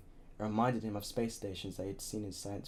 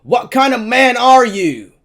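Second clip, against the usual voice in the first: huge, higher, slow furious yell; shouted; 26 dB louder, slower than usual. A narrator's reading. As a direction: roar it straight into his ear. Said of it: "and shouted".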